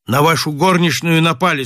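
plain reading voice

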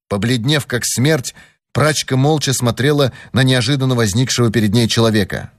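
plain reading voice

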